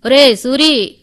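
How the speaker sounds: loud, high call across the road; shout. A narrator's reading. Chandrarao calling after Suri on the road, shouting. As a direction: yell his name to stop him leaving. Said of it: "అంటూ వేసిన కేకకు".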